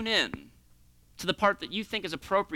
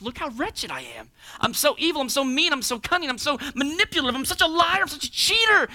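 self-loathing confession; 6 semitones higher than usual. Retold or imitated. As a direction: play the sinner listing his faults. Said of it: "you're like".

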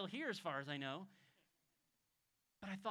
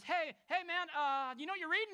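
higher, louder friendly hail; calling out; 9 semitones higher, 9 dB louder than usual. Retold or imitated. Philip hailing the reader on the carriage, loud and high. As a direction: call out to someone passing by. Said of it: "and he goes".

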